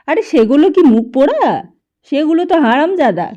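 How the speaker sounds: loud, high angry outburst; angry old man. A narrator's reading. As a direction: rage like a cranky grandfather. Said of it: "অঘোর দাদু রেগে গেল".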